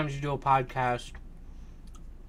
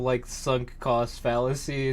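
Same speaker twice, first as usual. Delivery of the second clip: ordinary delivery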